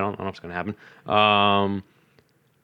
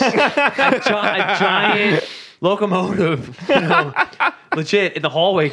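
laughing; 6 semitones higher, slower than usual. Real, not acted. Laughing while describing the Thomas mod.

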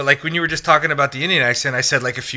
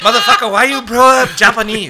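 mock outburst; 9 semitones higher, 4 dB louder than usual. Mock outburst amid accent game.

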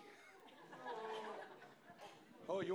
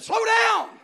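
high, loud nervous cry; panicked shout; 14 semitones higher, 13 dB louder than usual. Retold or imitated. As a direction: beg him to stop counting. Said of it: "I said".

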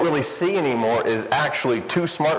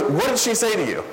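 exasperated friend; 9 semitones higher than usual. Retold or imitated. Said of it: "He goes".

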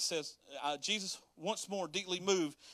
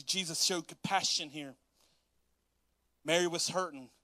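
ordinary talk

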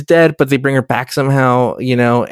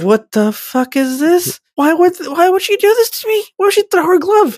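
bewildered stabbed guy. Retold or imitated. A podcast host voices the stabbed bystander in a very high bewildered squeak. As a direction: squeal it like a baffled extra who just got hit. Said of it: "he's like".

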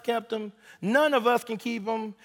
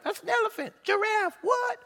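excited Adam naming animals; 11 semitones higher, 4 dB quieter than usual. Retold or imitated. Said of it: "He was like".

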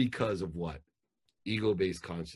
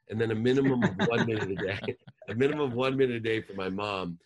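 laughing; 3 semitones higher, 5 dB louder, faster than usual. Real, not acted. Laughing about one minute for mom.

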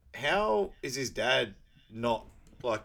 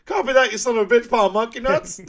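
much higher and louder radio yell, cracking into a laugh; mock trucker shout; 12 semitones higher, 12 dB louder than usual. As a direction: holler it over the CB. Acting trucker radio reply, laughing.

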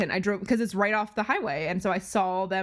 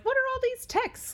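much higher, confused inner question; puzzled. Retold or imitated. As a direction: sound baffled by the flood of texts. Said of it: "I was like".

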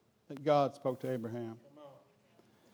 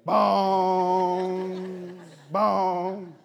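loud, high mock clock chime shouted out; hammed-up shouted bong, breaking into a laugh; Big Ben bong; 6 semitones higher, 12 dB louder than usual. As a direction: boom it out like a giant clock striking. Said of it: "Hers was sounding like Big Ben".

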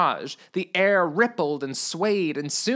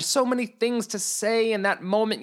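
plain reading voice

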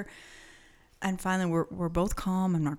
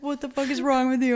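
angry; 6 semitones higher, 6 dB louder, faster than usual. Retold or imitated. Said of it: "And he's like".

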